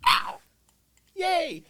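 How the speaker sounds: high squeal of joy; excited cheer. Retold or imitated. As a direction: cheer it, thrilled. Delighted at dinner invite.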